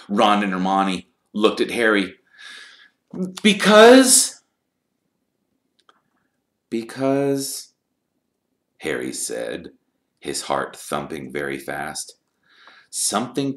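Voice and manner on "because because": nervous stalling. A narrator's reading. Harry stuck for an excuse, nervous and high. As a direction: blurt it, hang, then try once more.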